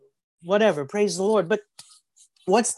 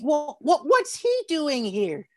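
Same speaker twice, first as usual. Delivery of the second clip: higher, slow bewildered question; baffled; 8 semitones higher, slower than usual. Acted. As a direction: sound genuinely puzzled at the sight. Bewildered onlookers in heaven.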